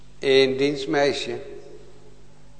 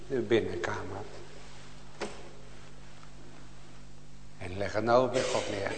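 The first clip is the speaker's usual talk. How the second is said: soft pleading; 3 semitones lower, 4 dB quieter than usual. Acted. Preacher quietly urging the congregation to pray, softly pleading. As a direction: lower your voice and plead gently with each listener.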